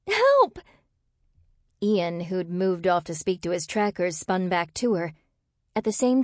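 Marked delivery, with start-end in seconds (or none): 0.1-0.6 very high, loud cry for help; panicked yell. A narrator's reading. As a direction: scream for rescue. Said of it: "she yelled".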